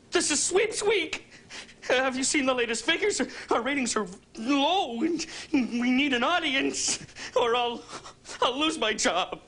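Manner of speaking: high, drawn-out whine breaking into crying; tearful pleading. Acted. Anchor afraid he will lose his job, tearful pleading. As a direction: plead slowly, on the edge of tears.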